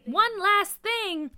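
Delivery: high, loud, drawn-out disbelief; indignant outburst. A narrator's reading. Kid reacting to one last task, indignant outburst. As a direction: echo it back in loud protest. Child protests at another task.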